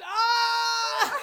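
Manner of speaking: high, drawn-out tearful "ah"; loud crying wail. Real, not acted. Wailing over needing a therapist, loud and high. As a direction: let out a long wail, half laughing. Wails after saying they need therapy.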